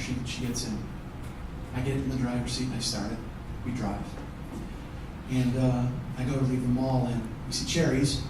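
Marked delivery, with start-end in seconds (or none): none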